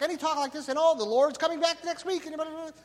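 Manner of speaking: very high chattering tape voice; chipmunk voice. Retold or imitated. Preacher mimicking the taped teacher on high speed, chipmunk voice. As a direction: pitch it way up and chatter like a sped-up cassette. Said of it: "literally a chipmunk".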